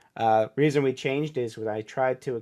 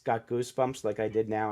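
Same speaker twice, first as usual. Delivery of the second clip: normal talking voice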